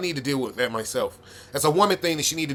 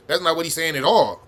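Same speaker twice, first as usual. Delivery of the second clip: normal talking voice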